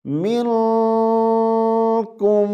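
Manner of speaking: منکم chanted very slowly; tajweed pronunciation. Shown as a demonstration. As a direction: stretch منکم in recitation. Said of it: "کیسے پڑھیں گے؟".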